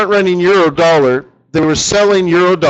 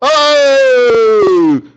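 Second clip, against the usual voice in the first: sudden high loud "Oh!"; surprised cry; 7 semitones higher, 5 dB louder than usual. Real, not acted. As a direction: burst out in surprise. Reacts to Fed news.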